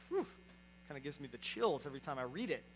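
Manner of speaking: usual speaking voice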